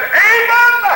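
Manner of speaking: high, loud preacher's cry of a name; ringing imitated divine call; God calling out. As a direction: call the name out like a summons from heaven. God calls out to Abram.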